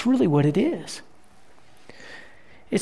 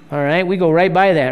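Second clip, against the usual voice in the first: ordinary talk, nothing marked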